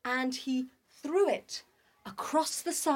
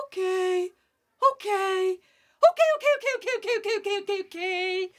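high excited okays; 7 semitones higher, 4 dB louder, faster than usual. Acted. Animals reply hastily.

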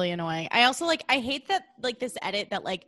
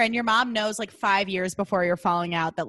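ordinary talk, nothing marked

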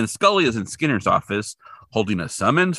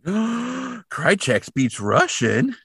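slow, higher, surprised question; mock gasp; 10 semitones higher, much slower than usual. Real, not acted. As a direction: gasp and stretch it out. Gasping at the news.

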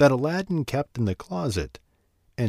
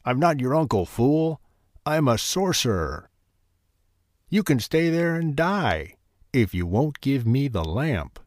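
gloating villain; 3 semitones higher than usual. Acted. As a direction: sneer it down at him. Sorcerer gloats over trapped boy.

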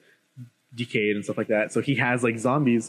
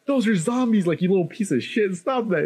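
mock exasperation, higher pitch; retold scolding; 8 semitones higher than usual. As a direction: imitate telling off a brat. Retold angry scolding of the brat.

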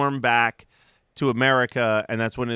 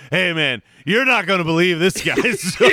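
louder, higher, laughing through the quote; cracking up while quoting; 4 semitones higher, 5 dB louder than usual. Retold or imitated. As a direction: act out the line, breaking into laughter. Retold ghost line, cracking up.

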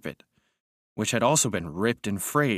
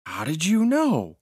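higher, drawn-out astonished question; amazed and slow, voice lifted high; astonished cry; 7 semitones higher, slower than usual. A narrator's reading. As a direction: cry out in amazement, pitch climbing. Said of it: "he cried, astonished".

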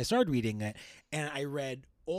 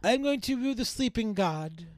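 put-on society girl voice; 9 semitones higher, 3 dB louder than usual. Echoing the letter in character.

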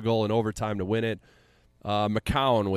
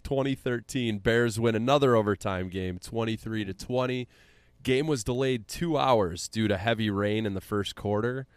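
usual speaking voice

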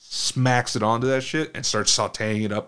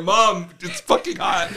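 laughing complaint; 8 semitones higher, 5 dB louder than usual. Retold or imitated. Pleading with mom over fumes.

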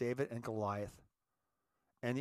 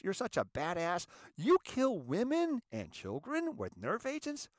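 high-pitched, incredulous outrage; indignant rebuke; 6 semitones higher than usual. Acted. Lecturer rebuking invaders who kill women and children, indignant. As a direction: challenge the invaders with rising indignation.

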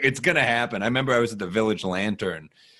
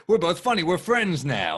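higher, chirpy make-believe line; mock movie cheer; 8 semitones higher than usual. Comic mocking how movie friendships start, chirpy and higher. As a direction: play it like a cheesy movie moment. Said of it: "it's not like in the movies".

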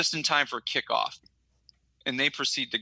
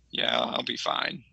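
dejected mumble; 12 semitones lower, slower than usual. Retold or imitated. Sad boy answering brother.

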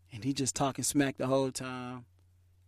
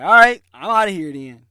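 loud; 12 dB louder than usual. Retold or imitated. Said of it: "I'm like".

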